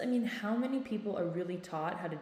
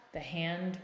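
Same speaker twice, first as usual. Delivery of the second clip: ordinary talk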